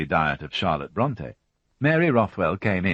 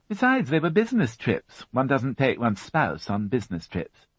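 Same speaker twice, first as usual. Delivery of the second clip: lightly raised fussy housewife voice; a higher, brisk widow answering the policeman; Mary Rothwell voiced; 7 semitones higher than usual. A narrator's reading. Widow answering the inspector, a higher fussy voice.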